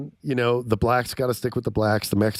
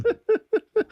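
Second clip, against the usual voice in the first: a squeaky high laugh instead of the line; high laughing mock Freeman. Failed Morgan Freeman impression turning into a high laugh. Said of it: "but not like that".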